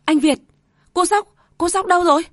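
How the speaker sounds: high, loud, fast panicked calling; a mother waking in terror for her child; panicked cry. A narrator's reading. Mother jolts awake, panicking for her son. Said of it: "bật dậy hốt hoảng".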